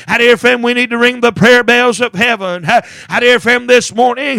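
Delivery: ordinary delivery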